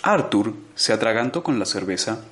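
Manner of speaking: plain reading voice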